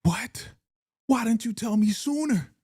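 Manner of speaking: imagined Tiff turning it on Keisha, indignant; mock accusing Tiff. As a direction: play Tiff flipping the blame. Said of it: "be a devil and be like".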